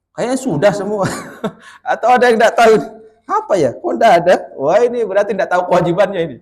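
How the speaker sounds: puzzled self-talk. Acted. Husband tallying his duties to his wife, puzzled and laughing. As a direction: act out a husband unable to count his duties.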